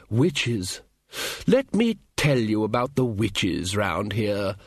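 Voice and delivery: very slow, weighty announcement; portentous old wizard. Acted. Ridcully about to lecture companions on witches, portentously. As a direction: draw it out like a dire warning.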